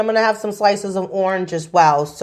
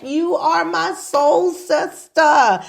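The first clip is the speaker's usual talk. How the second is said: gushing; 8 semitones higher, slower than usual. Retold or imitated. Client's excited reply retold.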